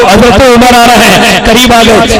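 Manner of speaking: ordinary talk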